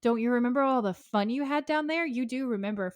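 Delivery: lightly voiced taunting little girl; mock-sweet girlish teasing; little girl Lilith. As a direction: pitch it up for a playful child demon. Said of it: "And Lilith says".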